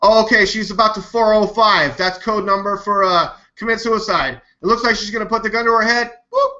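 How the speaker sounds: high, fast mock eager commentator; mocking the commenter. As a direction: ham up a breathless video narrator. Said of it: "he goes".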